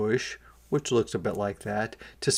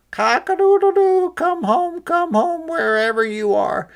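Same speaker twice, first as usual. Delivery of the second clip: much higher, louder crowing call; rooster crowing; 6 dB louder than usual. A narrator's reading. Rooster calling the chick home, high loud crow.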